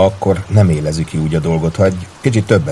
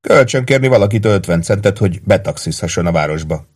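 plain reading voice

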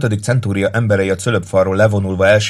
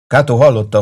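plain reading voice

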